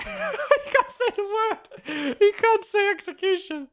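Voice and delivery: very high, laughing squeal; helpless high-pitched giggling; cracking up. Real, not acted. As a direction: talk through a real fit of laughter. Laughs at someone failing a word.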